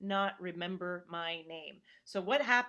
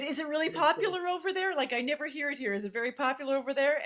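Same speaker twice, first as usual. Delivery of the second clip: eager question; 6 semitones higher, 4 dB louder, faster than usual. Retold or imitated. Acting her own eager question.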